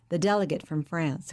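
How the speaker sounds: ordinary delivery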